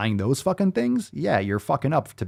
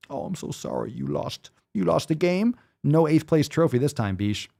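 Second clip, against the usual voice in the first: mock-pitying, higher and partly whispered; sarcastic sympathy; 5 semitones higher than usual. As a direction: fake comfort a loser, dripping sarcasm. Sarcastic fake sympathy to dieter.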